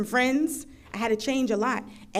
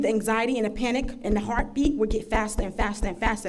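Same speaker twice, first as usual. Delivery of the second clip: usual speaking voice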